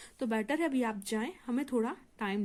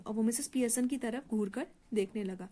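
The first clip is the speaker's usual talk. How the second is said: normal talking voice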